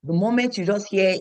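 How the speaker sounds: ordinary talk, nothing marked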